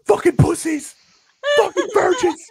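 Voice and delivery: imitated high, loud heckle; mad fan yelling. Rival fans at celebrating fans, mad imitated shout. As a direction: hurl abuse like an irate crowd. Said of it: "they're so mad".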